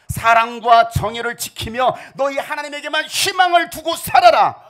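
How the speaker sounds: prophetic command. Acted. Prophet's command quoted loud.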